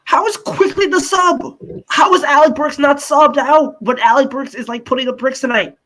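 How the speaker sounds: high-pitched exasperated ranting; incredulous outrage. Real, not acted. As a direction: demand an answer, voice shooting up. Repeats the outraged question.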